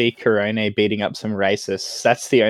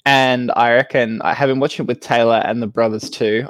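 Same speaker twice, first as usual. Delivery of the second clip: ordinary delivery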